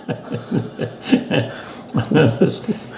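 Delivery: breathy chuckle. Real, not acted. Laughs at his dad's punch.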